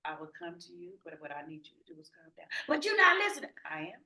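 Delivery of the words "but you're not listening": high loud protest; imitating upset wife. Wife on the phone, protesting, in a high loud voice. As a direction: mimic her cutting in.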